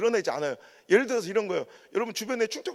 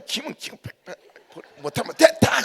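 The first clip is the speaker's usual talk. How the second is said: blunt Gyeongsang talk; 3 semitones higher, 4 dB louder than usual. Retold or imitated. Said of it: "경상도는 뭔가 막 확실해".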